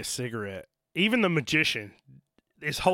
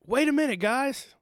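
high whiny protest; 11 semitones higher than usual. Retold or imitated. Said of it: "he's like".

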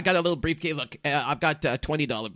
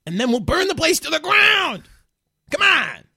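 loud, high, full-throated shout; rallying yell; 11 dB louder than usual. Acted. Manager urging the star into the plan, rousing yell. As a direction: yell it like a wild battle cry.

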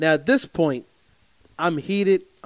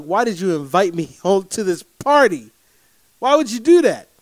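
rising, exasperated mock rant ending loud and high; retold angry outburst; 4 semitones higher, 4 dB louder than usual. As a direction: replay the fed-up question with heat, louder at the end. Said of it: "I'm pissed, bro".

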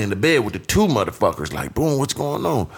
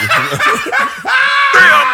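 loud laughing yell; laughing shout; 7 dB louder than usual. Real, not acted. Laughing outburst at Baccarat joke.

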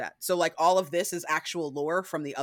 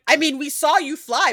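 loud, high exasperation; fed up with the dodge; exasperated everyone; 9 semitones higher, 9 dB louder than usual. Retold or imitated. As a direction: everyone calling out Lestat, exasperated. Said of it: "Everyone's like".

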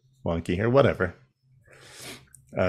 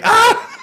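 mock death cry; 8 dB louder than usual. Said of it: "And he's like".

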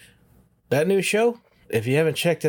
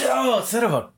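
about to sneeze; 6 semitones higher than usual. Real, not acted. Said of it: "I'm gonna sneeze".

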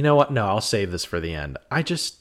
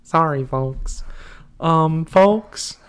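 higher, slow put-on ghostly host; Ghostly Puppers voice; 5 semitones higher, 4 dB louder, much slower than usual. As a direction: ham up a spooky host sign-off. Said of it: "Ghostly Puppers says folks".

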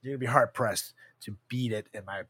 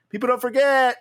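quoted movie line; 11 semitones higher, 8 dB louder than usual. Retold or imitated. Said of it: "lines I still say from".

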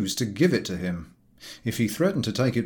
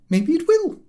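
high, louder lady's retort; rising key; 5 dB louder than usual. Acted. Angry mother retorts to the chief, voice rising high. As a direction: snap it back with the pitch climbing. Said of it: "her key continuing to rise".

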